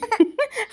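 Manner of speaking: short high-pitched giggle. Real, not acted. Vlogger giggling at her own joke to viewers. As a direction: let out a bright little giggle. Laugh burst.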